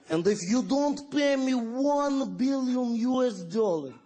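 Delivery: slow, menacing mock Russian accent; hammy movie-villain Russian accent, dragged out; Boris, Russian villain. Terrorist villain addressing the United Nations, slow mock Russian accent. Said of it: "then Boris comes on".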